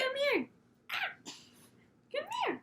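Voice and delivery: high cooing pet voice. Real, not acted. Cooing at the dog on camera, baby talk. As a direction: coo at the puppy.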